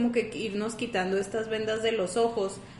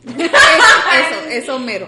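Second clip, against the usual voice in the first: laughing; 3 semitones higher, 14 dB louder than usual. Real, not acted. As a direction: laugh out the agreement. Still laughing, agreeing.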